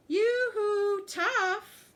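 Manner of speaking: high, loud sing-song yoo-hoo; loud call. A narrator's reading. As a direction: call out across the bridge. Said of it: "called loudly after".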